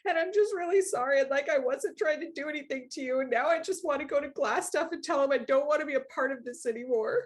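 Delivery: put-on high, pleading young teen voice, words tumbling out; a scared boy confessing everything at once; lightly tearful pleading kid, no real sobs; frightened kid. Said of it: "he is just bawling".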